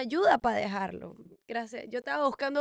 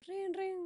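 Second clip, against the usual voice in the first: timbre de teléfono imitado; 8 semitones higher, 4 dB quieter than usual. Retold or imitated. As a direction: ring agudo de teléfono. (timbre agudo imitado)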